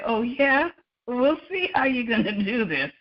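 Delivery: a high, teasing voice for the course; mocking taunt. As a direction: taunt like a smug rival. Personified course taunting the student.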